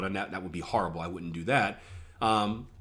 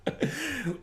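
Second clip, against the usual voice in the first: a short high laugh at his own brag; sheepish laugh; 14 semitones higher, 5 dB quieter than usual. Real, not acted. Trader laughing at his own bragging, short high laugh. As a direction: laugh off the boast. Jokes about being too good.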